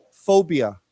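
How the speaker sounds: "Phobia" said alone and clearly; word modelling. Shown as a demonstration. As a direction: model "Phobia" for learners to echo. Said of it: "let's say that word together".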